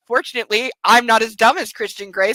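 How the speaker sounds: usual speaking voice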